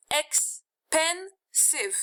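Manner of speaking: teacher drawing out each syllable of expensive; slow syllable demo. Shown as a demonstration. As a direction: stretch out ex-pen-sive so each syllable is heard. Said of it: "how many syllables do you hear?".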